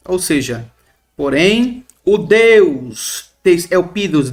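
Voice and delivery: normal talking voice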